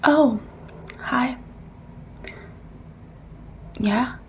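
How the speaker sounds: usual speaking voice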